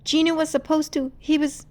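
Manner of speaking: high, rushed and urgent; pleading explanation. Acted. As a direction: explain before they leave. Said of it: "Rumi tried to explain".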